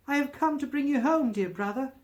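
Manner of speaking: put-on high, eager child's voice; a delighted little sister greeting her brother; little girl. A narrator's reading. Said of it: "said the child".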